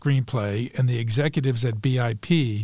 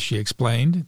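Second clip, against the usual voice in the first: ordinary delivery, nothing marked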